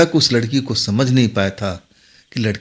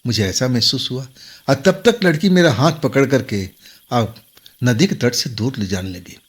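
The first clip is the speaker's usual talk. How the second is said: ordinary delivery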